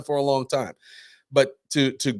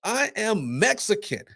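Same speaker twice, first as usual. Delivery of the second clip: high, slow, indignant mock claim; imitating the ranter; 10 semitones higher, slower than usual. The drunk ranter correcting the caller, high slow and indignant. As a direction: draw it out high, offended. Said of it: "the woman stops and goes".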